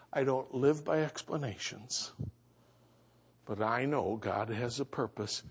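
low, hushed pastoral comfort; gentle consoling. Retold or imitated. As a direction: speak softly and low to a grieving mother. Retells consoling the bereaved mother.

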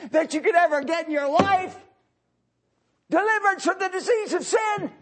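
loud, high revival preaching; impassioned sermon voice; fiery preaching. Acted. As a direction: preach it out to the crowd. Preaching at full force.

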